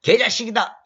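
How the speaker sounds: loud, high insulting shout; hurling a nasty insult at someone; mock insult. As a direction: spit the insult out. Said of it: "이렇게 말하면".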